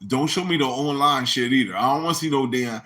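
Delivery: ordinary talk, nothing marked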